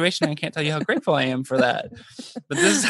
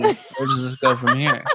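inner monologue; 7 semitones higher than usual. Retold or imitated. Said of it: "her thinking".

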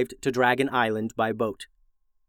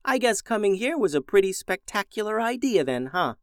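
smug; 8 semitones higher than usual. Acted. June teasing, higher.

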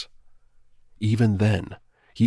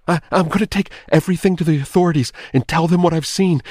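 fast, loud, high panicked wail; 10 semitones higher, 9 dB louder, faster than usual. A narrator's reading. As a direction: babble it out in terror. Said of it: "he wailed".

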